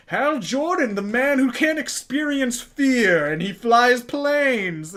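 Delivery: slow, high, hammed-up square-jawed hero; mock heroic voice. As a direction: lampoon the dull hero, drawn out. Mocking boring hero voice.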